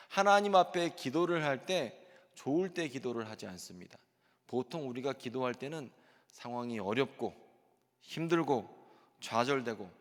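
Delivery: ordinary talk, nothing marked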